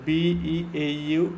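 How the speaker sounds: slow letter-by-letter spelling; measured classroom spelling; spelled out. Shown as a demonstration. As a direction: spell it out slowly, one letter at a time. Teacher spells out the word slowly.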